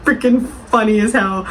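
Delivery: words through leftover laughter; laughing while talking. Real, not acted. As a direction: talk while still cracking up. Still laughing at the review.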